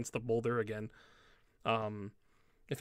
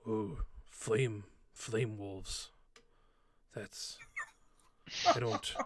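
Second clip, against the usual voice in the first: halting, softer orc thinking aloud; pondering orc; 2 semitones lower, 4 dB quieter, much slower than usual. Acted. Game master as orc puzzling over the howl, pondering. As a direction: work it out slowly in broken orc speech, with long pauses. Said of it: "And you hear him go".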